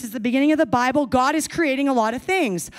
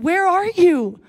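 calling out; 7 semitones higher, 6 dB louder, slower than usual. Acted. God calling to hiding man.

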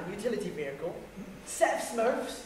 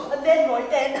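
gasping laugh; 4 semitones higher, 10 dB louder than usual. Real, not acted. Can't get story out laughing.

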